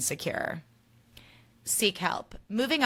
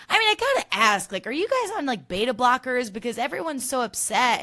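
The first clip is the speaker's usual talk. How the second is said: high, loud mock disbelief; squeaky-high imitation of an astonished question; incredulous retelling; 5 semitones higher, 5 dB louder than usual. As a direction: pitch it way up, baffled by their calm. Retelling host's incredulous question to cast.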